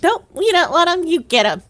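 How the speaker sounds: loud, dismissive voice of France refusing; mock France. France refuses its prisoners, loud mock dismissal. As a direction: toss them off like unwanted guests. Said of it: "France was like".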